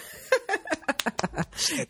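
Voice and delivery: breathy burst of laughter; real laughter. Real, not acted. Laughing at the made-in-Canada kit. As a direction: laugh hard at the twist.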